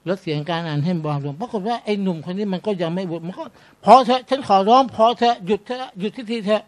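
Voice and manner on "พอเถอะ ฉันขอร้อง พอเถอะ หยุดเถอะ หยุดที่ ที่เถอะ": begging to stop. Retold or imitated. Youth begs sheikh to stop.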